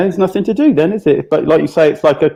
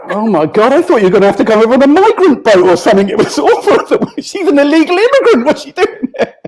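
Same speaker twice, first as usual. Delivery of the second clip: laughing tease; 9 semitones higher than usual. Real, not acted. Co-host joking, high.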